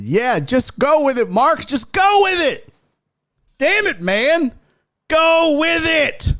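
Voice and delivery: high, strained mock outrage; mock exasperated yelling. Streamer telling a puzzled viewer to play along, mock exasperated. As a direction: plead and scold at a high pitch.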